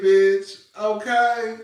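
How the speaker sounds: usual speaking voice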